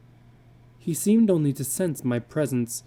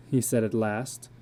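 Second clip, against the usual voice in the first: ordinary delivery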